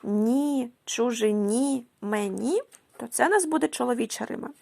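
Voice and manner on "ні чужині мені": "ні, чужині, мені" with each final НІ held; last-syllable stress. Shown as a demonstration. Teacher showing masculine rhyme, "ні, чужині, мені" stressed on the last syllable. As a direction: lean on the closing НІ of "чужині" and "мені", slowly. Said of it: "наголос падає на останньому складі".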